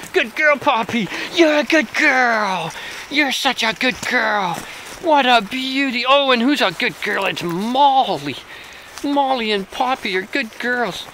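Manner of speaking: high, slow, gushing pet talk; doggy praise voice. Real, not acted. Owner celebrating the dog after the car ride, high gushing pet talk.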